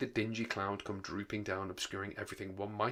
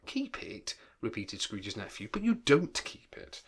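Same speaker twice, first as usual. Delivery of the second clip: incredulous; slower than usual. Acted. Nephew's incredulous repeat.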